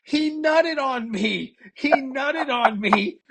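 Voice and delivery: high, drawn-out mock scream of outrage. Podcaster acting out a woman chasing a man through the store, mock screaming. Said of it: "She's screaming and she goes".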